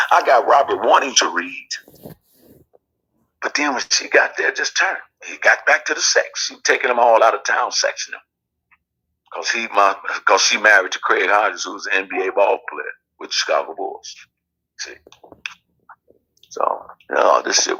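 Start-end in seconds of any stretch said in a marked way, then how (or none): none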